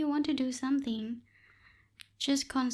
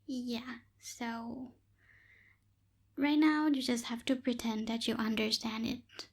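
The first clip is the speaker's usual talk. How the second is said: normal talking voice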